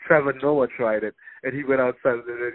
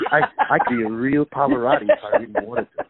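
laughing brag; 9 semitones higher than usual. Real, not acted. Joking about singing from balcony.

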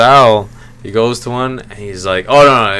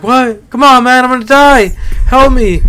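very high, loud mock pleading; frantic comic begging; mock desperation; 7 dB louder than usual. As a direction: cry out like a hammed-up dying man. Acted desperate begging, retold video.

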